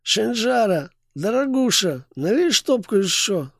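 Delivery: put-on high, slow lisping drunk; slushy lisp. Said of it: "шепеляво произнёс".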